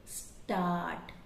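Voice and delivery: स्टार्ट slowly with a long aa; slow start. Shown as a demonstration. Teacher modelling start slowly. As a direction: drag स्टार्ट with an open aa. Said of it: "स्टैट नहीं बोलते".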